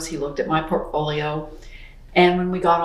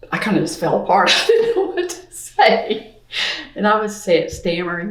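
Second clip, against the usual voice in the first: laughing while talking; 2 semitones higher than usual. Real, not acted. Telling the interviewer how she fell apart, laughing. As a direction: laugh through the words, pitch jumping up.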